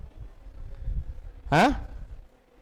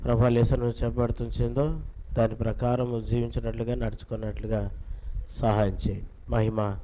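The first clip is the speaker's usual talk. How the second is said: low, softer prayer voice; 4 semitones lower, 6 dB quieter than usual. Real, not acted. Teacher closing a prayer before the children's Bible class, praying. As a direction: pray in a lowered, even voice.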